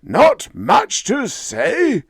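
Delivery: loud, high, imperious voice of a vast mind; a disembodied intelligence scorning its visitors; booming cosmic intelligence. A narrator's reading. Cosmic voice addressing Bill and Molly, loud and imperious.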